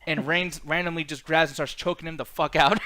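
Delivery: ordinary talk, nothing marked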